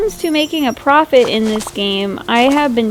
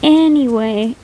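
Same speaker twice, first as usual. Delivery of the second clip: normal talking voice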